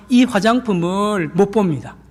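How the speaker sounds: ordinary talk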